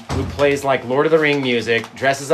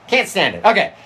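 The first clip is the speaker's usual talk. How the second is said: ordinary talk, nothing marked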